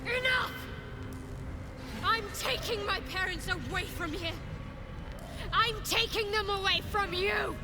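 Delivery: high-pitched, impassioned defiance; defiant outburst. Acted. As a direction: cry out in defiance at a captor. Defies goddess to free parents.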